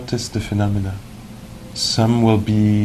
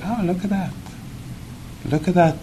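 gentle wonder; 8 semitones higher, 5 dB quieter, faster than usual. Acted. Acting out gentle noticing in meditation.

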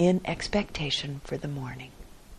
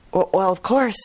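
stammering; 3 semitones higher, 4 dB louder, faster than usual. Acted. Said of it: "stammered the farmer".

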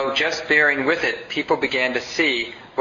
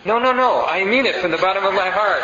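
gushing flatterer; 7 semitones higher than usual. Retold or imitated. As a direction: overdo the sincere flatterer, laughing. Flatterer protesting sincerity.

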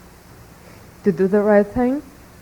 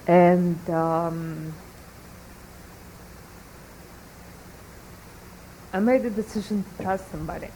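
soft, halting, fighting real tears; holding back tears; 10 dB quieter than usual. Real, not acted. Student admits a foolish decision to the teacher, holding back tears. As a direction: confess it quietly, with long pauses, trying not to cry. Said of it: "I'll try not to cry".

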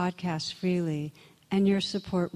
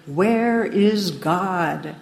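louder, very drawn-out stern demand; raised stern voice; 6 dB louder, much slower than usual. Retold or imitated. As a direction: raise your voice sternly, stretching every word. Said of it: "raised his voice and said even more sternly".

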